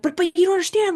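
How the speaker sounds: high, loud squeal of disbelief; shocked angry woman. Retold or imitated. Mocking the woman whose car was robbed: high shrieking disbelief. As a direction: mimic a stranger shrieking in shock. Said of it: "she's like".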